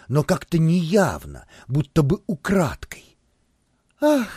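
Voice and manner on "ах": high, loud thrilled nymph voice; rustling excited gasp. A narrator's reading. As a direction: gush over him breathlessly. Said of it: "прошелестела нимфа".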